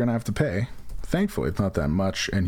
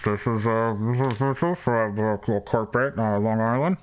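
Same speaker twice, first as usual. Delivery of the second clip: stiff conference-call voice; corporate caller voice. Retold or imitated. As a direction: announce yourself like a corporate guy on a call. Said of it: "And it's always like".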